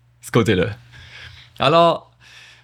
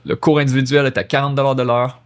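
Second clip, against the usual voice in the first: quicker second read of the price line; quick repeat; faster than usual. Shown as a demonstration. Teacher rereading the price line for students, quickly. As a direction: read it again briskly, x1.5. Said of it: "vingt, quarante piastres".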